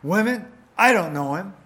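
loud, high, panicked denial. Acted. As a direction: push the accusation away hard. Peter denies, voiced quote.

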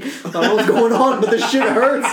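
loud, high, laughing through a mock complaint; laughing pained plea. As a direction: beg for relief while cracking up. Retold pleading while in pain.

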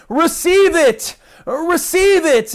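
high, slow, drawn-out preacher call; preacher's cry. Retold or imitated. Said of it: "was saying things like".

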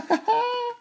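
very high squeaky laugh; tiny cookie's mocking giggle; gleeful cackle. Gingerbread man laughs at those chasing him, high giggle.

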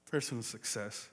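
a hushed, rueful repeat of personal success; quiet echo. Real, not acted. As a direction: let personal success sink in, softly.

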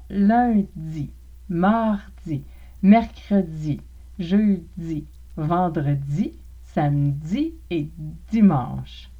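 lundi, mardi, mercredi with di said as dzi; slow careful dzi in each day; affricated days. Shown as a demonstration. Teacher drilling the days with affricated di. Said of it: "pour pratiquer l'affrication".

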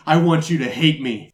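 usual speaking voice

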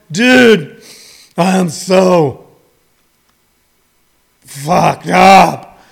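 mock drunk, with long pauses; wasted partygoer. Comedian imitating wasted friends at a party, drunken drawl. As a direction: struggle to get each phrase out, hammed-up drunk. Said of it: "the only thing you could get out of your mouth".

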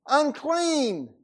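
high, loud cry of Unclean; shouted warning. Acted. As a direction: call out the warning so all keep away. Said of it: "to shout the words".

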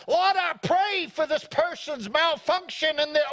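high, booming mock prayer; mock overblown intercession, high-pitched; loud awkward public prayer. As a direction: pray over them far too publicly. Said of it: "should pray quietly 'cause it can be awkward".

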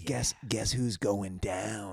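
slow, drawn-out competitive taunt; in-your-face voice. Shown as a demonstration. Guest demonstrates his slow competitive video voice. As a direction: draw it out like a trash-talker. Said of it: "competitive, like, in your face voice".

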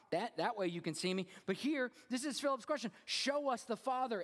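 high, driving pulpit delivery; high-pitched, forceful sermon voice; impassioned preaching. Acted. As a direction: preach it with rising urgency.

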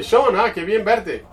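high, bright-eyed hello to a friend; delighted greeting. Retold or imitated. Greeting a returning visitor.

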